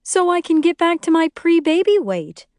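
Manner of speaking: high, loud, as if to an idiot; condescending. A narrator's reading. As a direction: spell out the obvious with condescension. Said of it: "like I'm an idiot".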